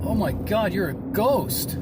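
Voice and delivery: high, loud, drawn-out disbelief; hammed-up astonished cry; shocked realisation. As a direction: act out shock at seeing a ghost. Character realises brother is a ghost.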